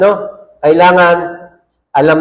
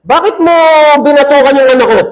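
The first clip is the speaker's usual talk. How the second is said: much higher, loud demanding challenge; angry father; 7 semitones higher, 4 dB louder, faster than usual. Retold or imitated. Pastor retelling the furious father confronting him, angry demand. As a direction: fire it back like an outraged dad. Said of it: "galit na galit sa akin".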